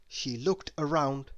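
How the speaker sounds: ordinary delivery, nothing marked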